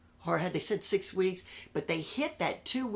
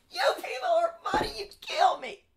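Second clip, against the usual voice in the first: much higher, louder laughing outburst; laughing loudly; 16 dB louder than usual. Retold or imitated. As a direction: burst out laughing at the threat. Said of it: "I just laughed and laughed".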